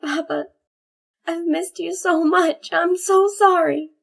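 high filly voice in tearful apology; sorry through tears, small and high; filly crying. Acted. Daughter apologising tearfully to her father in a high filly voice. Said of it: "Snowbolt was also crying".

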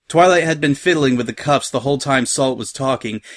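plain reading voice

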